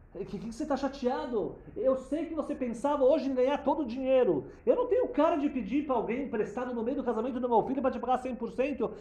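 high, loud urgent plea; loud pleading. Retold or imitated. Father urging the crying caterer, loud and high.

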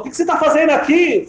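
put-on old man demanding why Gedalia is lying there; stern old man. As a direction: challenge him sharply.

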